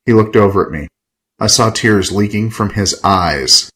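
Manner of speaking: usual speaking voice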